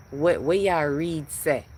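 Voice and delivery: slow Jamaican patois lilt; Jamaican accent. Acted. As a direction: ask it in a drawn-out Jamaican accent. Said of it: "with my Jamaican voice".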